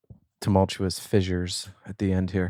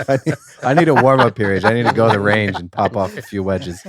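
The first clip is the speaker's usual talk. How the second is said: loud and laughing; 9 dB louder than usual. Real, not acted. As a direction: laugh while joking at yourself. Joking about his cigar mishap.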